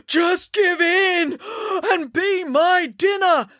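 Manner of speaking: slow, high giant call between gasps; winded calling. A narrator's reading. Giant pleading with his fleeing dinner, winded high call. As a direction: call out, puffing hard from the chase. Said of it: "called the giant".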